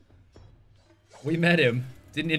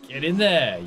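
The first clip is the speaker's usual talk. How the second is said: loud, high cheer; triumphant cheer; 5 semitones higher, 7 dB louder, slower than usual. Real, not acted. Player celebrating success in game, triumphant. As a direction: cheer the move home.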